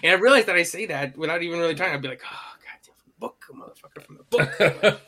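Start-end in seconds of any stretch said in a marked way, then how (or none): 2.2-4.0 whispered movie-line catchphrase, much softer; whispered imitation. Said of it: "And he does like this". 4.0-5.0 louder and higher, laughing through the catchphrase; laughing imitation. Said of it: "And he does like this".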